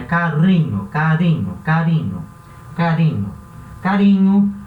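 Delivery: carinho com R simples e leve, "ca-ri-nho"; single tapped R. Shown as a demonstration. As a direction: um toque só da língua no R de carinho. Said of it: "o som igual o R do português". (R brando em carinho)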